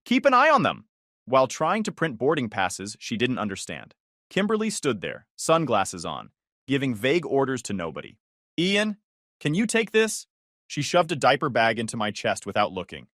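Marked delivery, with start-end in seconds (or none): 0.0-0.9 high, loud yell across terminal. Acted. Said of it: "Mom yelled". 8.6-10.3 entitled sister. Acted. Sister ordering him.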